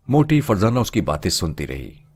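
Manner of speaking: usual speaking voice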